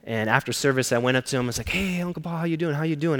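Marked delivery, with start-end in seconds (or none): 1.7-3.2 put-on bright, higher greeting to an old uncle; cheerful greeting. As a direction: call out a happy hello. Greeting uncle after service.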